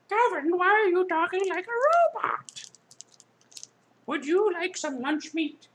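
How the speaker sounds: squeaky cartoon voice; high silly voice.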